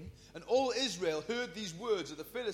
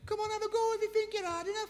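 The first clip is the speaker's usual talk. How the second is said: much higher, fast cocky schoolboy goading; mouthy kid taunt; 13 semitones higher, faster than usual. Retold or imitated. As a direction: squeak out a fast dare like a playground wind-up. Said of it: "He's not like".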